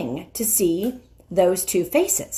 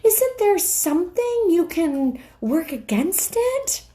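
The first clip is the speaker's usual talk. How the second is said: put-on much higher, anxious pleading girl voice; 12 semitones higher than usual. Susan pleading into the lion's ear. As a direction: beg him urgently. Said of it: "whispered Susan in the lion's ear".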